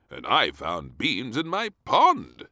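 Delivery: a gruff officer reporting; admiral voice. Acted. Admiral at the door, mild complaint. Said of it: "said the admiral".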